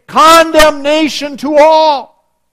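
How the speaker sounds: very loud, high, slow herald's cry; mock proclamation. What angels didn't say at the birth, mock proclamation. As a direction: boom it out as a pretend heavenly announcement. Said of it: "the angels didn't say".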